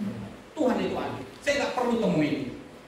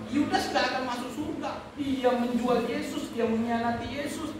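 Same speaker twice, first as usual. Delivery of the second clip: higher, drawn-out insistent protest; retold objection; 5 semitones higher than usual. Preacher reacting to article on Judas, mildly indignant.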